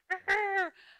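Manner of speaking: a sudden high, loud laugh that breaks the sentence off; burst of laughter. Real, not acted. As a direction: laugh it off, half embarrassed. Mother bear urge, breaks off.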